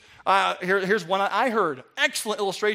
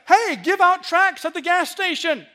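mock preacher; 9 semitones higher, 5 dB louder than usual. Imitating preacher's application.

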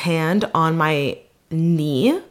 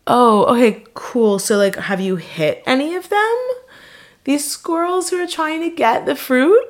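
fake cheerful interest; 9 semitones higher than usual. Her own fake enthusiasm, quoted.

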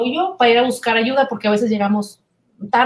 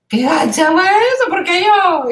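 higher, indignant whine of a friend balking; mock indignant friend; 7 semitones higher, 4 dB louder than usual. Friend protests being sent to therapy, mock indignant. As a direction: play the offended friend, half joking.

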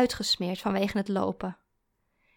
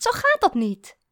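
high, louder protest; outraged, raised-pitch objection; indignant; 10 semitones higher, 6 dB louder than usual. A narrator's reading. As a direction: scold him with indignation. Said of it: "zei ze verontwaardigd".

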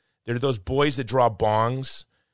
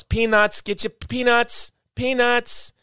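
very high, loud peanut vendor cry; stadium vendor hawking; 12 semitones higher, 5 dB louder, slower than usual. Retold or imitated. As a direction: sing it out like a ballpark vendor. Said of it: "you know what else".

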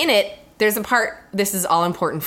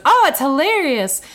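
high, louder imitated enthusiasm; delighted reply; 7 semitones higher, 5 dB louder than usual. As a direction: gush it, imitating his amusement. Acting his delighted reply.

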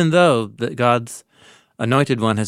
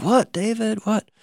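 startled Saul; 11 semitones higher than usual. Retold or imitated. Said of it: "And Saul's like".

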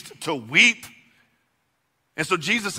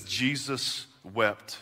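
slow solemn reading; 5 semitones lower, 8 dB quieter, much slower than usual. Real, not acted. Preacher reads key verse.

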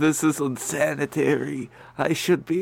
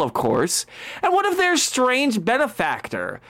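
measured storytelling scene setting; game master narration; 4 semitones higher than usual. Acted. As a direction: set the hellish scene like a DM.

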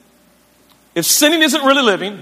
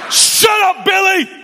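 put-on high-pitched yell at a child; acted exasperated car-ride shout; angry parent snap; 9 semitones higher than usual. Acted parent snapping on drive to church.

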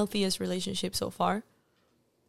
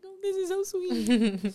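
high, slow mock swoon; 8 semitones higher, slower than usual. Artist recalling his sweet line, high slow swoon. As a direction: melt at how sweet he is.

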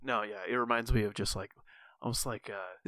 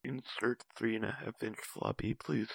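monotone computer voice reading a command; robotic text-to-speech delivery; Microsoft Sam voice; 5 dB quieter than usual. Pretend. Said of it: "Microsoft Sam impression".

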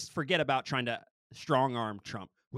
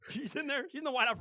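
laughing; 12 semitones higher than usual. Real, not acted. Laughing at own joke.

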